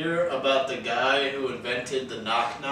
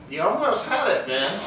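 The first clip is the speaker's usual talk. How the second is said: ordinary talk, nothing marked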